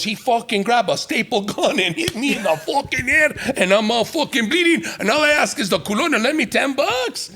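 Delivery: high, rising hyped voice; imitating bleeding drunk. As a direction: squeak it out like a wild drunk. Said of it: "He goes".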